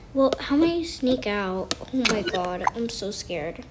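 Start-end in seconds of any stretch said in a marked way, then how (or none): none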